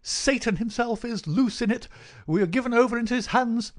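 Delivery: high, loud, agitated vicar in bursts; frantic gasping cry. A narrator's reading. Frightened vicar bursts in on Holmes, frantic. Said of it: "he cried".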